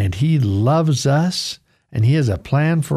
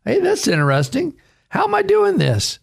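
amazed; 8 semitones higher than usual. Acted. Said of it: "He's probably thinking".

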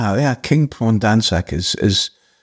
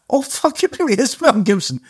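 higher, gasping realisation; stunned disbelief; 13 semitones higher than usual. Retold or imitated. Actor realises Mel Gibson is on the phone, stunned and high. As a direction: let the shock break through, pitch jumping up. Retold shock at star calling.

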